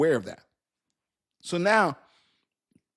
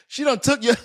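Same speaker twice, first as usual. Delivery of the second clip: laughing disbelief; 6 semitones higher than usual. Real, not acted. Laughing retelling of betrayal.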